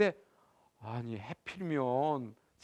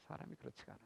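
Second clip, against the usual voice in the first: 사람이 그렇지가 않아요 repeated much softer, almost to himself; soft echo; 4 semitones lower, 27 dB quieter, much faster than usual. Shown as a demonstration. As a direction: let the repeat fall away to a murmur. (사람이 그렇지가 않아요를 아주 작게 되뇌듯)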